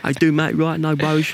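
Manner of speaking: a hint of a cheerful chavvy woman; playful mock of the examiner's casual mate-talk. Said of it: "quite small and quite chavvy".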